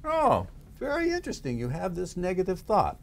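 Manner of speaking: high, intrigued therapist voice; high-pitched mock clinical fascination; detached therapist. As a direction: play a curious observer studying a specimen. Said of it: "Imagine that you are your own therapist".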